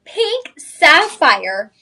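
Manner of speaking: loud, high, very drawn-out announcement; excited big reveal. Real, not acted. Host repeating the reveal to shoppers, loud drawn-out excitement. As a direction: stretch each word out high and loud.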